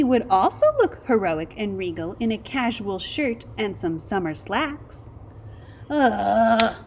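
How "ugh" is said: annoyed ugh. A narrator's reading. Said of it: "said the other naked mole rats".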